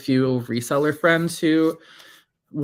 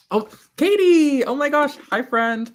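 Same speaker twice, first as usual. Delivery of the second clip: much higher, louder hello; excited high greeting; 14 semitones higher, 7 dB louder than usual. Real, not acted. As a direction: squeal hello to a friend. Greeting a new viewer.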